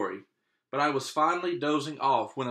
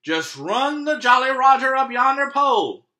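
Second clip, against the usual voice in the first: higher, louder hearty captain; hearty pirate captain; 11 semitones higher, 9 dB louder than usual. Acted. Captain bidding the boy farewell, hearty. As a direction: boom it out like a proud captain.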